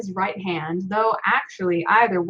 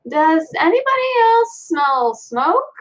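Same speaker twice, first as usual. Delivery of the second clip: forced calm question; 8 semitones higher, 4 dB louder, slower than usual. Acted. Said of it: "trying to keep my voice calm".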